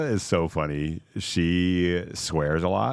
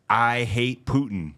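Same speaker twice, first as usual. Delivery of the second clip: imitating a slow, theatrical proclamation; slow mock-dramatic announcement; dramatic declaration; 5 semitones higher, slower than usual. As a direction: declare it grandly, drawing out each word, as a party-game impersonation. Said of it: "very dramatically and was like".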